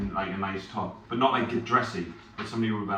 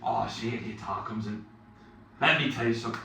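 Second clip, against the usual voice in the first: ordinary talk, nothing marked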